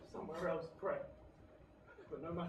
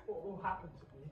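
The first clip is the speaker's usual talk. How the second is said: fearful murmur; 5 semitones lower, 10 dB quieter, faster than usual. Acted. Faustus fearful question.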